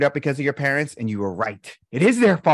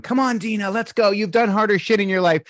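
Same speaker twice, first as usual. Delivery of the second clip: coaching pep talk; 5 semitones higher, 3 dB louder than usual. Retold or imitated. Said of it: "And I'm going".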